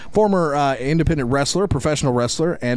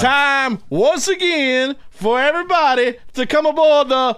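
announcer voice; 13 semitones higher, slower than usual. Retold or imitated. Wrestling entrance catchphrase.

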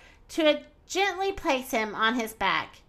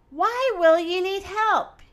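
trumpeted; 7 semitones higher than usual. A narrator's reading. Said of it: "he trumped to Kibu".

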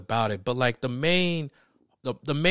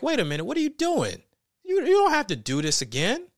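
higher-pitched, incredulous protest; mock disbelief; 10 semitones higher than usual. Viewer protesting the joke's second appearance, incredulous. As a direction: object as if taken aback, pitched up. Acts out objection to repeated joke.

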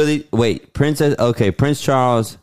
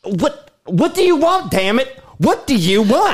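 much higher, demanding; desperate shouted plea; 9 semitones higher than usual. Acted. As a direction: yell the question at her. Acted quarrel climax.

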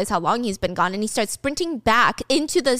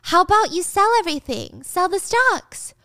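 Gia acting stupid; 8 semitones higher than usual. Gia playing dumb.

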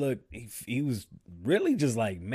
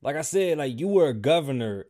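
normal talking voice

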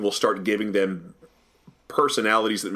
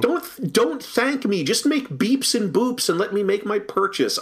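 much higher, mock-pleading to the machine; pitched-up comic exasperation; exasperated plea; 9 semitones higher than usual. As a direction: beg the checkout to just stop talking.

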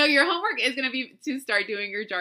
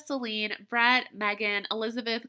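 usual speaking voice

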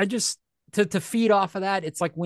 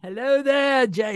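high mock Aussie call; Australian accent; 8 semitones higher, 3 dB louder, slower than usual. Host greeting co-host in jest, mock Australian accent. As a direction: sing out a put-on Australian greeting. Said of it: "We're Australian".

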